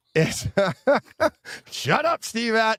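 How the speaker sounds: high laughing shut up; real giggly plea to a chat member; laughing protest. Real, not acted. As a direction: laugh while telling him off. Teasing chat member mid-laugh.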